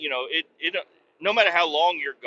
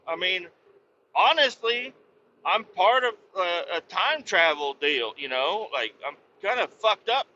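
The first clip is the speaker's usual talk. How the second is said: higher, whiny explaining; high complaining; 6 semitones higher than usual. Retold or imitated. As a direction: explain time travel to the shooter, whining. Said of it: "I'm like".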